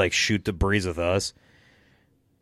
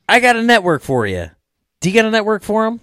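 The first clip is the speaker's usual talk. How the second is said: hyped-up pitch; 12 semitones higher, 6 dB louder, faster than usual. Real, not acted. Hype plug for the podcast network.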